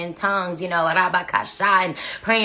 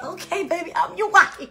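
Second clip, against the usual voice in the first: high giddy reply breaking into a laugh; 11 semitones higher than usual. Retold or imitated. Bride-to-be answering her fiancé, laughing and giddy. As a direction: laugh through it, thrilled. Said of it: "I'm like".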